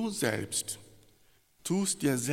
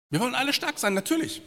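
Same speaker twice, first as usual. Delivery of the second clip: mock strong; 11 semitones higher, 5 dB louder, much faster than usual. Preacher mocks wanting strength.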